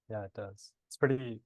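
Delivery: ordinary talk, nothing marked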